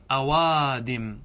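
أوادم stretched slowly; teacher stretching the example أوادم; slow demo word. Shown as a demonstration. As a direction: draw out أوادم for the class. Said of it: "فتقول".

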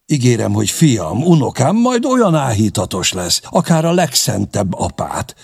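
high-pitched, eager character voice; animated protesting retainer; Csanád, wry warrior, animated. Acted. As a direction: argue your case with lively, lifted pitch. Narrator plays Csanád pleading his case.

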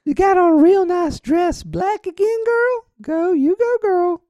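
man doing a woman's voice. Acted. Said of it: "lady voice?".

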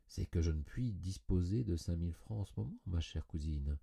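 ordinary delivery

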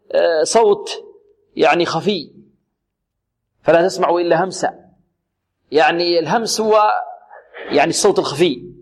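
normal talking voice

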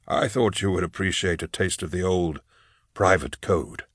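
put-on soft, understated voice of an old master; old inquisitor mentor. Eisenhorn to his former pupil about their code, quietly voiced.

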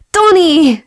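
loud, very high, falling shout; elated "Tony!" at full reunion pitch; joyful cry. A narrator's reading. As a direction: shout the name, running into an embrace. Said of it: "Szaladt és ugrott a nyakába".

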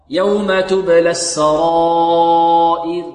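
very slow chant, long held aa in السرائر; four-count madd. Shown as a demonstration. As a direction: stretch السرائر, then a clear kasra and thin ر. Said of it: "إذن السرائر أربع حركات".

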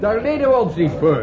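loud, firm indignant protest; indignant refusal. Acted. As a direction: reject it flatly, raising the voice. Professors indignantly refuse statement.

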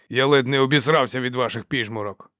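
usual speaking voice

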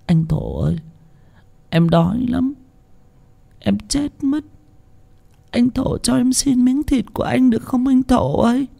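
high, soft, faint pleading; weak feeble rasp. A narrator's reading. Starving little sister begging her brother for flesh, faint feeble plea. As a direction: barely get the words out, fading from hunger. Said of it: "thều thào nói".

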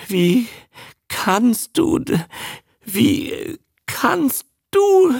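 very high, slow, halting sobs; sobbing reproach. A narrator's reading. Trembling woman reproaching the man who left her, sobbing. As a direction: sob it word by word, teeth chattering. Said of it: "schluchzte sie".

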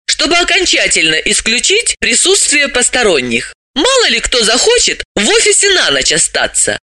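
high-pitched, lively explainer; high character voice, matter-of-fact; animated female character, explaining. Acted. Voices Dalila in dialogue.

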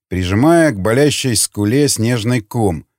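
ordinary delivery

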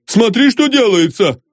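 high alarmed outcry; flustered, high-pitched appeal; frightened cry. A narrator's reading. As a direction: call her to witness, panicked. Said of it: "закричал испуганный инженер".

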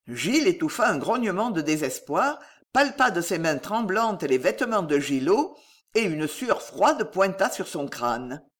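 plain reading voice

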